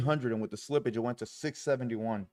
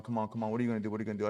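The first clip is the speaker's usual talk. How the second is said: usual speaking voice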